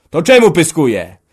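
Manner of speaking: high, very loud angry retorts; yelling ever harder. A narrator's reading. Son defends his wife against father, yelling. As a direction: build the shout with each phrase. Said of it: "wrzeszczał coraz mocniej Antek".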